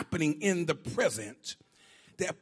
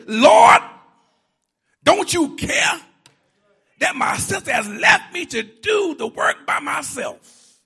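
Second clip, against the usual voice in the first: loud, high, aggrieved shouting at the Lord; mock Martha complaining; 6 semitones higher, 9 dB louder than usual. Martha complains to Jesus about her sister, loud aggrieved shout. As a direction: whine it out, indignant and put-upon.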